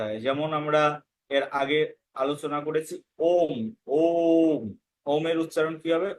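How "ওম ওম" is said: ওম with a long, held vowel; drawn-out Om. Shown as a demonstration. Teacher demonstrates Sanskrit pronunciation to students, long ওম. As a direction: hold ওম long and heavy. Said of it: "একটু দীর্ঘ করে উচ্চারণ করতে হবে".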